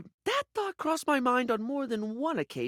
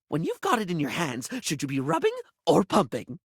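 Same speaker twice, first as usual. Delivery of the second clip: ordinary talk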